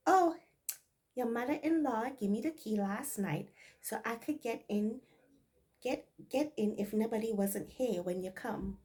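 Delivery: light Bajan dialect explanation; easy Barbados speech; Bajan accent. Maid explaining the key in Bajan dialect. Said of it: "this is Bajan dialect".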